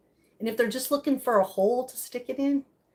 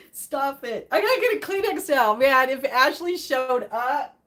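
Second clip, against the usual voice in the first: ordinary talk, nothing marked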